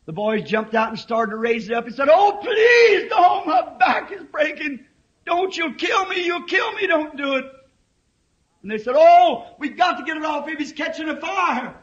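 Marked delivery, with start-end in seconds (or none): 2.1-7.4 high, loud cry of pain and panic; boy's desperate pleading. Retold or imitated. Pinned boy begging the men not to lift the car, panicked high cry. 9.0-11.7 loud, high call to act now; urgent shout. Retold or imitated. Boys shouting to each other to lift the car, urgent high shout.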